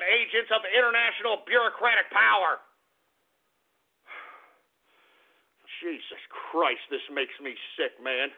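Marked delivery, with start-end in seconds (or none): 4.1-8.3 deflate after the outburst; a spent host muttering in disgust; exhausted sigh. Acted. Host collapses after his rant.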